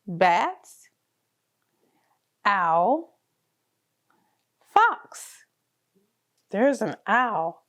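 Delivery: normal talking voice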